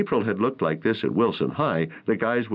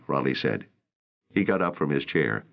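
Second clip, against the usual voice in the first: plain reading voice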